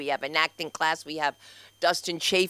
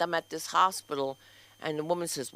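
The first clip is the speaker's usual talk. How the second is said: normal talking voice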